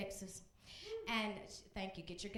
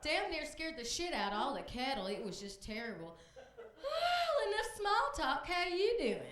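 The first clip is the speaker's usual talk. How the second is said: put-on higher, breezy old lady, cracking up at the end; lightly voiced Texas grandma; 7 semitones higher than usual. A grandma on the Sunday call telling awful news breezily. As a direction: tell the tragedy as casual chit-chat. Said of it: "And she said".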